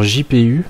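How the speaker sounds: "J P U" said as French letters, ji pé u, not the English gee-pee-you; French-accented GPU. Real, not acted. Reading GPU off the site in French letters, joking about his accent. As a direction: spell "J P U" the French way with a self-mocking accent. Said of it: "avec mon super accent".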